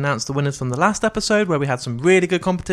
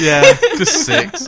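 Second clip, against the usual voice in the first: loud, laughing shout of the score; 13 semitones higher, 9 dB louder, slower than usual. Real, not acted. Host shouting the film's score at co-hosts, laughing. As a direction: bark the number out, laughing. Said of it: "he shouted".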